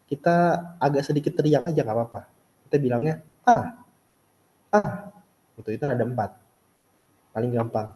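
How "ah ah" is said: Ah said sharply from high, like a short mock yell; angry falling tone. Shown as a demonstration. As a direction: snap "Ah" downward as if annoyed. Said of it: "Nada empat ini kayak orang lagi marah".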